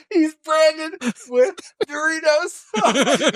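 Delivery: high, slow, laughing till tears; gasping out words through laughter; helpless laughing fit; crying with laughter. Real, not acted. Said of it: "I had a full tear down my face".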